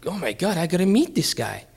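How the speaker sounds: high-pitched eager exclamation; thrilled retelling; excited reaction. Retold or imitated. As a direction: react like you can't wait, voice lifted high. His own excited reaction retold.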